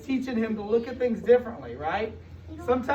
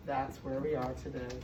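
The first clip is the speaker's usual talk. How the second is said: usual speaking voice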